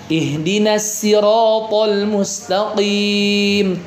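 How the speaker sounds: "Ihdinas siratal" with a plain s, slow and chanted; imam's sin reading. Shown as a demonstration. Teacher voices an imam reading with sin. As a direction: recite slowly, sin not sod. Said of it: "Ada imam yang baca".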